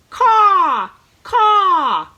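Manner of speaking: loud, high crow caw; cawing crow imitation. Shown as a demonstration. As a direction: call out like a crow. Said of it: "the crow and the raven sound like this".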